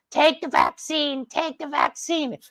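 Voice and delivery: slow, very high parody chant; high, slow mocking imitation of protesters; mock screaming protesters. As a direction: whine it out like a mocked crowd. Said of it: "who are the ones screaming in the streets".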